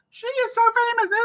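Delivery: very high, awed fan imitation; mock starstruck squeal. People in the spa learning who the visitor is, mock starstruck squeal. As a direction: squeal it as an amazed onlooker.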